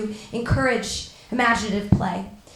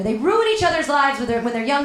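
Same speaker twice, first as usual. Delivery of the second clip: usual speaking voice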